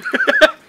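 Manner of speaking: squealing giggle, very high; high shrieking laugh. Real, not acted. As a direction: burst into a shrill laugh after the shock. Laughing after the dousing.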